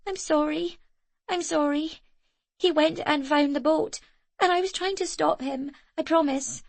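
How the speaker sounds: put-on high, pleading girl's voice; a scared child begging forgiveness; desperate apology. A narrator's reading. Frightened girl apologising to Zoe. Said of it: "she was saying over and over".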